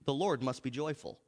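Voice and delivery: ordinary talk, nothing marked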